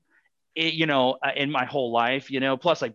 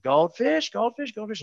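put-on high, singsong kid nagging for goldfish; begging kid; 9 semitones higher than usual. Little son in Target begging dad, high singsong. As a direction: imitate a small son pestering. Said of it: "he's saying".

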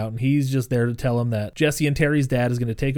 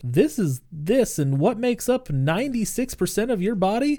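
high singsong lecturing imitation; corny teacher; 8 semitones higher than usual. Dorky science teacher quizzing kids, high mock-teacher voice. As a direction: quiz the class in a high, lilting voice.

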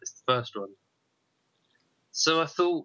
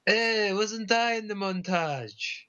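higher, slow, drawn-out put-on character voice; Rolf; 7 semitones higher, slower than usual. Host imitating the Muppet dog for co-host, bad impression. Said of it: "do a bad impression of Rolf".

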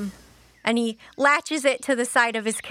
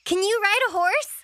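put-on higher, innocent kid voice; child asking grandpa; 8 semitones higher, faster than usual. Child asking grandpa about horses, innocent. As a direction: ask it high, like a curious child.

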